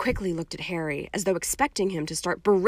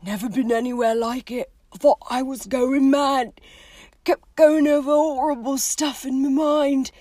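higher, pained voice building louder; haunted, sorrowful gamekeeper; 6 semitones higher, 5 dB louder than usual. Acted. Hagrid telling his friends about Azkaban, haunted and building. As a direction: recall the horror, rising towards tears.

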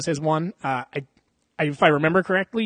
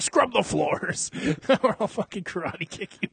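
mean adopter voice; 4 semitones higher than usual. Retold or imitated. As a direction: order her around like a cruel guardian. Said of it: "the shitty guy that adopts her".

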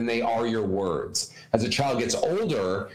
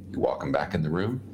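ordinary delivery

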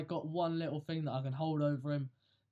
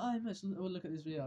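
higher-pitched start, imitating a video opener; mock video intro; 4 semitones higher, 3 dB quieter than usual. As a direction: put on a light video-presenter opening. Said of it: "start off one of them videos".

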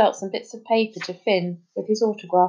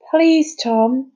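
higher, louder plea with a drawn-out please; pleading child's appeal; 5 semitones higher, 5 dB louder than usual. A narrator's reading. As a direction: beg him to relent. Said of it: "he pleaded".

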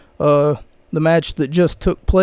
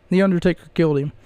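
ordinary delivery

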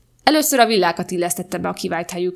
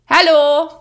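loud, high call through the door; loud high greeting; 8 semitones higher, 9 dB louder than usual. A narrator's reading. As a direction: shout a bright hello down the stairs. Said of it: "Marcia kikiáltott".

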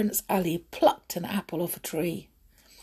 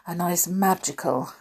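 ordinary delivery, nothing marked

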